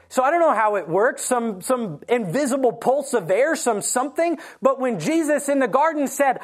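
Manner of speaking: ordinary talk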